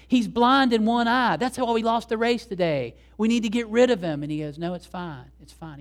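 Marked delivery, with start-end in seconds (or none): 0.1-4.2 high, loud, indignant retelling; heated, pitched-up accusation; furious complaint. Retold or imitated. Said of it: "And he's furious". 4.6-5.8 soft, unbothered dismissal; quietly waving it off, trailing softer; calm reassurance. Retold or imitated. Owner calms the furious trainer.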